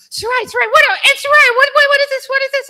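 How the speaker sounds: put-on high, loud kid voice calling her name; nephews' excitement. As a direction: kids tugging at their aunt's sleeve. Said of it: "my nephews are like".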